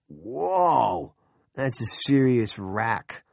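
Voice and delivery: slow, drawn-out admiring "whoa"; impressed confiding. A narrator's reading. Junior confides in gaping older man, slow admiration. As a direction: draw it out slowly, impressed. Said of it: "Junior confided to the gaping Thurston Marshall".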